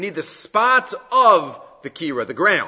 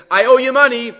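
hammed-up loud borrower; loud mock declaration; 8 semitones higher, 7 dB louder than usual. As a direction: call it out high and loud.

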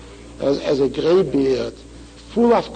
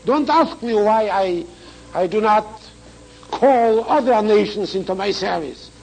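ordinary delivery

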